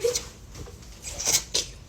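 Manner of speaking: whispered, slow insult; under-breath curse. Retold or imitated. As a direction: hiss it so no one hears.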